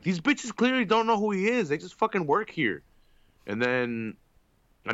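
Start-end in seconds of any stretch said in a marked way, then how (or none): 0.0-2.8 mock angry rant. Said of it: "And I'm like".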